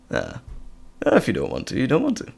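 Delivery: ordinary talk, nothing marked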